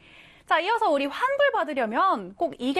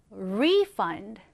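refund said RE-fund, weight up front; stress on first syllable; 6 semitones lower than usual. Shown as a demonstration. Teacher repeats refund as a noun for learners, stressed on RE. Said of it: "앞에 강세가 들어가게 됩니다".

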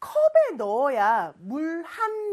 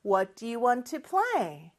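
slow, clearly spaced English; measured teacher demonstration; slow model question; much slower than usual. Shown as a demonstration. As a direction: model the question slowly for repeating. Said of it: "큰 목소리로 해볼까요".

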